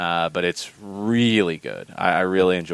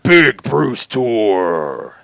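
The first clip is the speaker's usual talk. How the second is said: slow, louder stretched announcer call; monster truck ad; 3 semitones higher, 5 dB louder, much slower than usual. Retold or imitated. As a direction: drag out every word like a monster truck ad. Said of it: "the monster truck announcer has to do that one".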